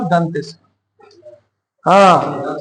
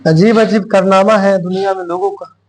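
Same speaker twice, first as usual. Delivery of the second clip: usual speaking voice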